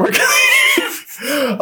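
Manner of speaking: high, very slow stretched panic; panicked stall. Retold or imitated. Telling a friend, stalling in panicked disbelief. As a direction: drag the words out in shock. Panicked drawn-out retold thought.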